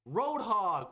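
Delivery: mock wrestling intro; hype announcement. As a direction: announce him like a ring MC. Announcing next opponent.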